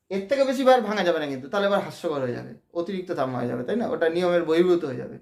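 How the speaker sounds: usual speaking voice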